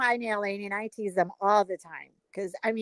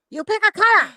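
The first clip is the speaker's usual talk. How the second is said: put-on raised, louder Chinese-accented question; mock Chinese accent; 7 semitones higher, 7 dB louder, faster than usual. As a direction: imitate a nail tech's Chinese accent. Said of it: "she does a perfect Chinese person".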